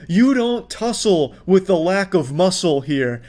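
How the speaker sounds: slow, drawn-out sarcastic hype; mock hype. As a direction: talk him up like a champion, tongue in cheek. Mock wrestling hype for customer.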